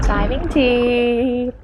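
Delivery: drawn-out tannoy announcement; intercom voice. Pretend. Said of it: "Why do we sound like an intercom at school?".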